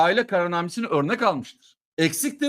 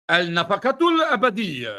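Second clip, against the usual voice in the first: mock Arabic. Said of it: "bunun Arapçası yok".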